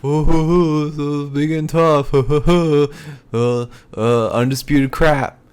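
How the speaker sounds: mock the rival's toughness; mocking taunt. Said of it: "and he's like".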